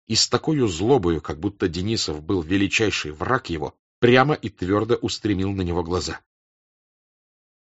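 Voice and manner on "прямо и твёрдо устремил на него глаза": usual speaking voice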